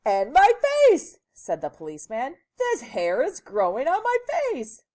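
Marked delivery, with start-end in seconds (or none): 0.0-1.2 loud, high outcry; high-pitched indignant shout; exasperated policeman. A narrator's reading. Policeman wails about his face. 2.6-4.8 loud, high-pitched complaint; agitated high shout; exasperated policeman. A narrator's reading.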